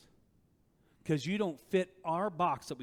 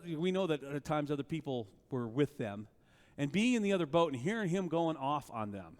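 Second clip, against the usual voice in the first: ordinary talk, nothing marked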